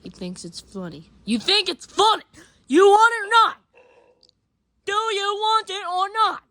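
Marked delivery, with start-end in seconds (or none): 1.2-6.4 loud, high demanding shout; angry yell. Acted. Yelling at Ben who laughed, loud and demanding. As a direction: bark the question at him.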